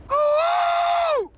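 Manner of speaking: high, loud joyful whoop; ecstatic whoop. Real, not acted. Morning preacher whooping for his viewers, ecstatic whoop. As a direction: let out a wild happy whoop. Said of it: "Man, I'm excited".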